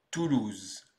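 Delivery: TOULOUSE said alone with falling pitch; TOULOUSE as an isolated French word; pronunciation demo. Shown as a demonstration. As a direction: model TOULOUSE for learners. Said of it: "Et on prononce donc".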